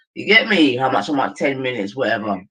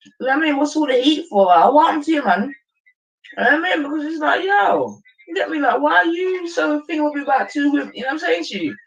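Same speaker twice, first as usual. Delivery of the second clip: high excited rant; 11 semitones higher than usual. Real, not acted.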